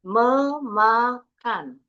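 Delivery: "memakan" slowly in three beats, me-ma-kan; split into syllables. Shown as a demonstration. Teacher showing Indonesian syllables, split slowly. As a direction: separate each syllable of "memakan". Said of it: "Ini ada tiga suku kata".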